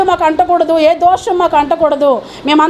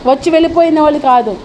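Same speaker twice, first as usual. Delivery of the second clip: usual speaking voice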